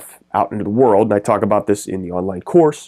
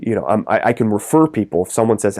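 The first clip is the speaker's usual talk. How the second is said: ordinary delivery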